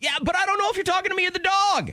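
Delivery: very high, fast, hammed-up outburst; acted exasperated yelp, rushed; exasperated retort. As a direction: protest in mock despair, voice shooting up. Husband's exasperated retort to wife.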